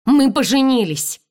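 high, loud confession; blurting out. Acted. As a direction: blurt the news out. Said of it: "я выпалила".

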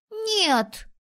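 put-on very high childish contradiction; stubborn boy. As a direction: contradict like a stubborn little kid. Boys quarrel about ghosts. (упрямо, детским голоском)

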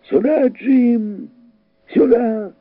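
high, drawn-out call; father calling his son over. A narrator's reading. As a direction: call slowly and high across a distance. Father calls son to him.

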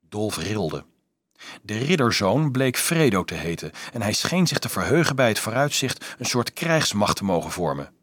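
ordinary delivery

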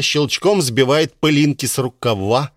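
ordinary delivery

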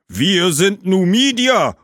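high, loud and slow proud call; booming ghosts. A narrator's reading. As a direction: announce yourselves as Numidian ghosts. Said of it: "tönte es aus anderer Richtung". (hoch, laut und langsam tönende Geister)

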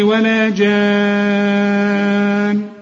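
"ولا جان" chanted slowly, the nun held long and humming; slow Quran recitation with long nasal hum; nasal ghunna on the nun. Shown as a demonstration. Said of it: "لازم أغنها".

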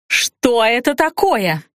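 slow, drawn-out indignant demand. A narrator's reading. Blonde demanding answers about her plate, slow indignation. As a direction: stretch out the outraged question. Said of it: "уже громче повторила блондинка".

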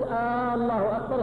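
آه، الله أكبر opened on a long held "aa"; "aaallahu akbar", stretched initial hamza; drawn-out questioning hamza. Shown as a demonstration. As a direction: lengthen the first vowel of الله so it becomes a question. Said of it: "استفهام".

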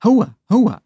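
high, loud mock ho-ho; playful ho-ho. A narrator's reading. Savka teases Agasha by the river, high playful ho-ho. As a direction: hoot it out high in two bursts.

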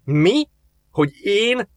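high, scoffing "Mi? Hogy én?"; disgusted disbelief. A narrator's reading. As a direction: scoff at the very idea. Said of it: "fintorgott Azaniel".

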